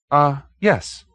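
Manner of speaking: high, eager fluttering agreement; flustered little man. Acted. Hoag agreeing to the detective, high and flustered. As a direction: hurry to agree, a bit flustered.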